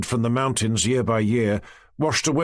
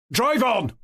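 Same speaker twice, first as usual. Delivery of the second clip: angry shout; 12 semitones higher than usual. A narrator's reading. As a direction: snap at the driver to go. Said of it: "shouted Olenin angrily".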